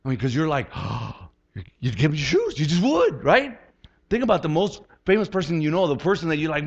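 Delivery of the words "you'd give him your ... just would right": rising excited insistence. Real, not acted.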